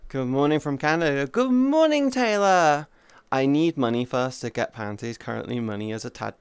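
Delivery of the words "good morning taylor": sing-song greeting. Real, not acted. Greeting a chatter.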